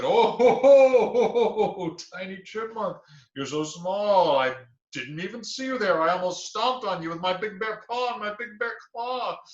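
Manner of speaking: loud, high "ho ho ho" then boastful talk; booming bear laugh. Acted. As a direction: laugh down at the tiny chipmunk.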